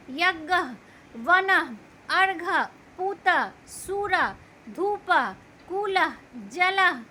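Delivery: a teacher sounding the final h on each word; visarga h sound. Shown as a demonstration. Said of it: "इनको बोलते हुए ह का उच्चारण होता है".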